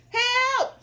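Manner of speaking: high, loud cartoon cry; frightened cook. Cook is asked to catch fish, hammed-up fright. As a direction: yelp it in hammed-up panic.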